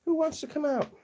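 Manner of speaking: very high-pitched call to pets; high, inviting sing-song; coaxing pet-talk. Real, not acted. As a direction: coax a shy animal out in a high little voice.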